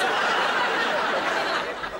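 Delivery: a high, loud cackle breaking into the story; high cackling laugh. Real, not acted. Laughs mid-story about the Queen.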